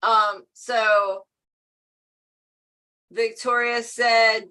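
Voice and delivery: ordinary talk, nothing marked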